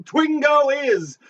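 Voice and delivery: high, slow, proud announcer call. Acted. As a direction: sell the title to the crowd. Host announcing the game title.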